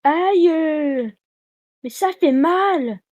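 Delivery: mock pain. Mock pain while playing.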